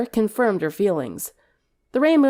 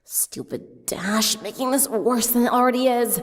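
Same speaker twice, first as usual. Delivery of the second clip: Sunset muttering; 4 semitones higher than usual. A narrator's reading. Sunset clenching a fist, grumbling about Dash. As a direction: grumble under your breath about Dash. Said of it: "She clenched a fist".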